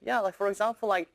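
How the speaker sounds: ordinary talk, nothing marked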